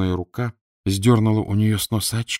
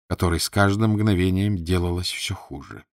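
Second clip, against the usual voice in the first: usual speaking voice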